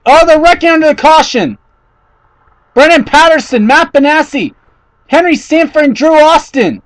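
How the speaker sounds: high, urgent calling of names; alarmed. Real, not acted. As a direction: list the cars fast in alarm. Another wreck under caution.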